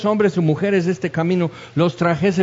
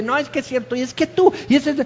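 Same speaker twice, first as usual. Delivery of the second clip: much higher mock quarrel voice; comic high-pitched argument, cut off midway; imitated bickering; 8 semitones higher, faster than usual. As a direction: act out a squabble, pitched way up. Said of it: "acribillándonos de".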